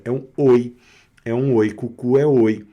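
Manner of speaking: normal talking voice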